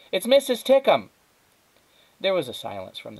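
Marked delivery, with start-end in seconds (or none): 0.1-1.1 high, loud shouted correction; raised voice, pitched high; shouting back. A narrator's reading. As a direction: holler the answer back to mother. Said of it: "Flora shouted back".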